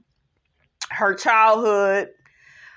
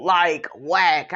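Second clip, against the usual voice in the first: ordinary talk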